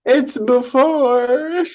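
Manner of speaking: very high, drawn-out mock holler; slow, loud, high-pitched shout; imitated yelling. Echoing the movie kid's yell for a friend, high drawn-out holler.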